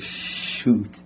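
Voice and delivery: breathless whispered shoot, barely there; whispered curse. Retold or imitated. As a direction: caught out, he curses under his breath. Realizes his lie is exposed.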